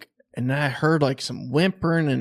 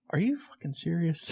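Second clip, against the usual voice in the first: retold disbelief; 5 semitones higher, 7 dB quieter than usual. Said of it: "I verbally said".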